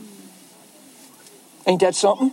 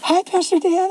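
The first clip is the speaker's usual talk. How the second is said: put-on girl's greeting; 9 semitones higher than usual. Young girl greets pastor in office.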